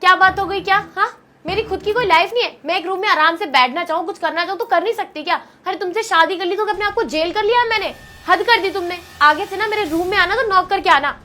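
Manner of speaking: loud, heated telling-off; angry scolding. Acted. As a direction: scold him for barging in. Wife keeps angrily scolding. (पति पर गुस्से से चिल्लाती पत्नी)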